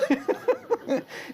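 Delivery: sudden high burst of laughter. Real, not acted. Lecturer laughing at the shawl-burning story, high laugh. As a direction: laugh out at the absurd tale.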